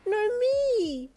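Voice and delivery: very high, loud wail; wailing little boy. Acted. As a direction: wail like an upset toddler. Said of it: "Goro wailed".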